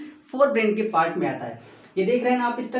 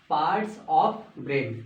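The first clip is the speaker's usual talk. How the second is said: parts of brain stretched out very slowly; teacher stressing the topic, very slow; slow, drawn-out emphasis; 5 semitones lower, much slower than usual. Shown as a demonstration. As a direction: spell the topic out slowly for the class. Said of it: "आज हम discuss करने वाले हैं".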